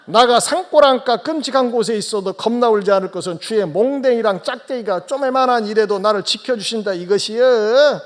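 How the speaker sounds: South Jeolla dialect. Acted. Pastor reads Psalm 23 in Jeolla dialect, hammed-up Jeolla accent. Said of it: "전라남도 버전입니다".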